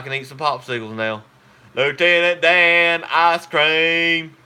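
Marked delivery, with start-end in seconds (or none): 1.7-4.4 slow, loud Forrest Gump drawl calling out; Forrest Gump impression. Forrest calling out to Lieutenant Dan, slow and loud. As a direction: stretch it out and holler like Forrest Gump. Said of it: "did y'all like my Forrest Gump imper-, impersonation?".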